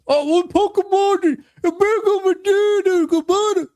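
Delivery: high, mocking whiny voice; mocking the chatter. Streamer mocking a chatter's argument in a high whiny voice. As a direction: parody the lazy argument in a high voice. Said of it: "don't just say things like".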